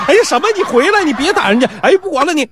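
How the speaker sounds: 尖高的女声急着叫住他; 拔高嗓门像媳妇追着喊; 妻子喊丈夫. Acted. 妻子又喊又嗔. (媳妇急忙叫住要去杀人的丈夫)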